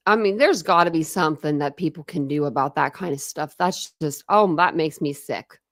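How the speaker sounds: ordinary talk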